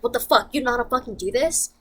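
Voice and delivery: dad yelling at his son, imitated; mock angry dad. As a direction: snap at him like an annoyed dad. Dad voiced angrily in story.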